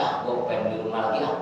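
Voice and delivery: low, soft, fast lazy mumble; lazy homebody. Retold or imitated. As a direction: grumble like a man who won't leave home. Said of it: "begitu katanya". (gumaman malas, cepat dan pelan)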